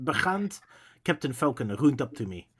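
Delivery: plain reading voice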